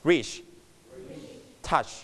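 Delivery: "reach", "touch", each with a brief ch; one short syllable each for "reach" and "touch"; short ch ending. Shown as a demonstration. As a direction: model the quick ch closing "reach" and "touch". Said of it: "C H เป็นเสียงสั้นนะครับ".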